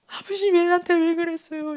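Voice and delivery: imitated whining sob, held high; tearful reproach. Son at home blames his father, tearful reproach. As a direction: wail the question like a hurt son. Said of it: "이러면서 막 우는 거예요".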